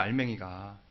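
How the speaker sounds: 알맹이가 repeated low and soft; quiet echo. Shown as a demonstration. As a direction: drop the voice on 알맹이가. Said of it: "알맹이가 빠져있어요".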